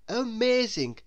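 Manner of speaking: ordinary delivery, nothing marked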